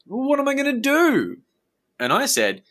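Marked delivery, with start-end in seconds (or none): 0.1-1.4 much higher, louder pleading question; desperate plea. Retold or imitated. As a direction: plead as if out of options. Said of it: "And he said".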